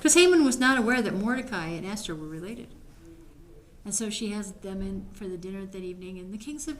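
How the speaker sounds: ordinary talk, nothing marked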